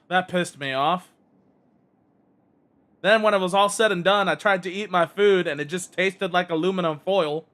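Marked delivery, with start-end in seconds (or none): none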